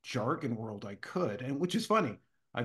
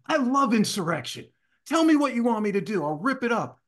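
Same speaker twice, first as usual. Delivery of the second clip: pumped, louder and higher; bold eager reply; 8 semitones higher, 7 dB louder than usual. Retold or imitated. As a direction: embrace the challenge with gusto. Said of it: "I go".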